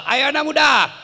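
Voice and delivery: high, loud urging call; rally the crowd to join in; rallying shout. Real, not acted. Preacher urges the young men to join, rallying shout.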